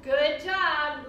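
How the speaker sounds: ordinary talk, nothing marked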